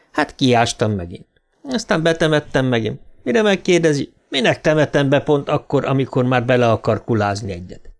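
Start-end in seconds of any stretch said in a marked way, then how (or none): none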